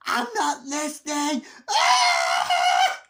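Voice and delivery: put-on very high, drawn-out childish scream; screamed defiance. Masked boy refusing to hear, imitated screaming tantrum. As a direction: scream it like a kid drowning someone out.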